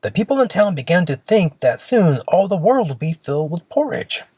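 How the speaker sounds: ordinary delivery, nothing marked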